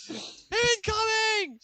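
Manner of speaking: high, stretched-out cry; mock alarm. Banker shouting as the bubble crashes down. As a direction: call it out slowly and high. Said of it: "And then boom".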